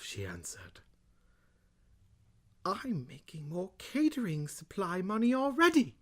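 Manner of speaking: theatrical British Bane, halting with long pauses; Bane-style British. Acted. Host dramatically reading kids' book, hammed-up British Bane. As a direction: swoop high on quotes, sink to hushed British narration. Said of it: "I'm gonna do British, uh, sort of my, you know, Bane one".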